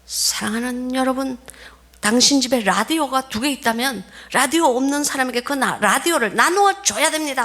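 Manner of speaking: high, pressing preacher voice; fervent preaching. Retold or imitated. Missionary preaching to villagers about sharing bread, high fervent preaching. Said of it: "이 사람이 다 그래요".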